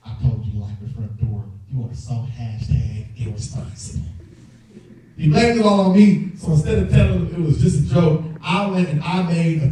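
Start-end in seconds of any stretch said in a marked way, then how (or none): 0.1-4.1 scolding dad. Retold or imitated. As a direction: blame him quietly like a disappointed dad. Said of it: "How could you let this happen".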